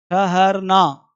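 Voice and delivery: ठहरना stretched very slowly; slow, drawn out. Shown as a demonstration. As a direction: draw out ठहरना syllable by syllable.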